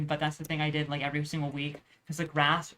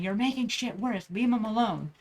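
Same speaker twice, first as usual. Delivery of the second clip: retold shout; 5 semitones higher than usual. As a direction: call it out over the noise, half-hearted. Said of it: "I just shout over it saying".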